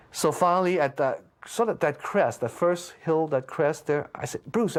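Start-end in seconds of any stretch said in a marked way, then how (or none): 4.5-4.8 a raised call across the distance. Retold or imitated. Runner calling to his friend far ahead, mild mock yell. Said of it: "I was yelling 'cause he was way".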